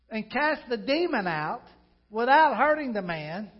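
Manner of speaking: loud, driving preacher's voice; preaching at full force. Acted. Pastor preaching the Capernaum story to his church, loud and driving. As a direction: preach it out to the congregation.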